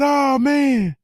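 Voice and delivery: high-pitched shocked "oh, man"; stunned high exclamation. Retold or imitated. Seeing the fighter's fishnet outfit.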